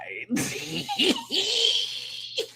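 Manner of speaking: long, high-pitched giggling cackle. Real, not acted. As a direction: crack up in a high squeal. Cracking up at quoted lyrics.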